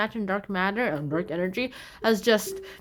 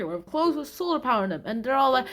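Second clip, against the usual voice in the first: ordinary delivery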